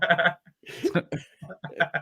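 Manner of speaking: laughing. Real, not acted. Laughing mid story.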